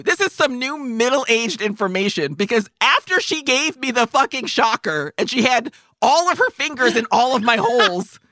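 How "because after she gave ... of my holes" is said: normal talking voice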